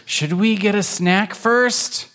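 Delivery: high, drawn-out question; lightly sheepish kitten asking; wondering kitten. Acted. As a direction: ask slowly in a high kitten voice. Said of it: "Pancake wonders".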